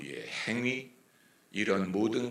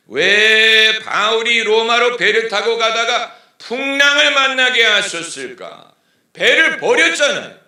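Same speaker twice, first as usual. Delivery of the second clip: full-volume preacher's call; preaching at full force; 13 semitones higher, 17 dB louder than usual. Acted. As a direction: cry it out from the pulpit. Preacher raises the question.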